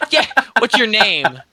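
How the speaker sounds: lightly hammed-up flirty stranger. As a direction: toss it out flirtatiously. Reader voices man asking name.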